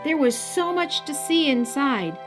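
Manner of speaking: usual speaking voice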